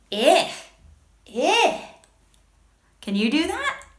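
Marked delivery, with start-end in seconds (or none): 0.1-1.8 "Eh" as a grossed-out short "ih"; yucky short I. Shown as a demonstration. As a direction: repeat "Eh" like the i in igloo, disgusted. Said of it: "Something really yucky".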